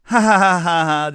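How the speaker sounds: high, loud forced "ha ha" of men who don't get it; fake awkward laugh. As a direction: laugh along without getting the joke. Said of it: "just a, like a laugh".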